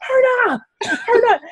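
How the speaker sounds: high, panicked call of a name. Retold or imitated. Scared runner calling her friend back, high panicked call. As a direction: yell for her friend in a fright.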